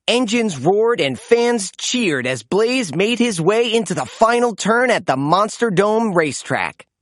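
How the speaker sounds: ordinary delivery, nothing marked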